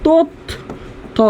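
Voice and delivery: তথ্য drawn out slowly as tottho, softly; final o sounded. Shown as a demonstration. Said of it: "শব্দের শেষে উচ্চারণ করি". (তথ্য শব্দের শেষে ও উচ্চারণ করে)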